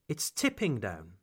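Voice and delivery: accent lands on "tipping", falling after it; stress on tipping. Shown as a demonstration. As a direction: lean on "tipping", let "down" drop. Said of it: "I accentuate the word tipping".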